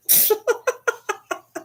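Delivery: high, squeaky staccato giggle; laugh. Real, not acted. Laughs at head-flying remark.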